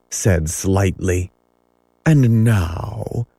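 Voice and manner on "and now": mildly courteous, measured; understated silky courtesy. A narrator's reading. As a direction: address them with smooth politeness. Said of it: "Hook said courteously".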